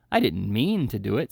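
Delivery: plain reading voice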